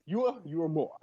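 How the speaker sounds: Uemura said softly, oo-eh-MOO-rah; Uemura, soft demo; name repeated. Shown as a demonstration. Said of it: "yeah, Uemura".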